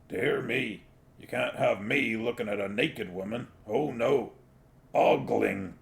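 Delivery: a hint of a gruff little dog, mock-shocked; lightly voiced dog Gaspode. A narrator's reading. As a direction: Gaspode feigning prudish dismay, lightly voiced.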